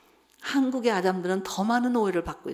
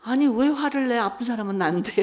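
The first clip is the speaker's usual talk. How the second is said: loud, raised-pitch indignant retort; indignant protest; 4 semitones higher, 6 dB louder than usual. Retold or imitated. Hurt wife answering her scolding husband, indignant. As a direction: protest as the wronged one.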